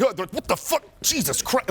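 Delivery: mock startled. Person approached from behind, acting startled. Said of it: "so you don't startle people".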